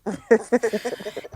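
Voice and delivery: quick amused laugh; light laugh. Real, not acted. Laughing at the banter.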